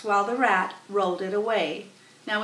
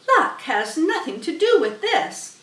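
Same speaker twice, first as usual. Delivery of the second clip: higher, louder indignant goose; goose's voice; 7 semitones higher, 5 dB louder than usual. Acted. Goose correcting Charlotte, indignant. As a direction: scoff at luck.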